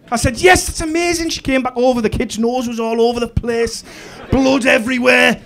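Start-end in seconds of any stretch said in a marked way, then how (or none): 0.4-1.3 high-pitched, thrilled cheer; elated praise. Retold or imitated. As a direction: praise a child in triumph. Dad celebrating daughter's punch.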